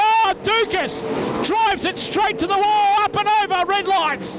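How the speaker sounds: excited crash call. Real, not acted.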